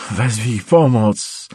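slow, strained cry for help; strained plea. Acted. Wounded man struggling for breath begs his captor, strained. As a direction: force out the plea, dragging each word.

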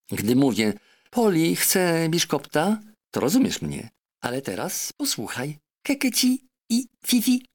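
a clever parrot lecturing her master. Acted. Parrot Polynesia urging the doctor, high and insistent.

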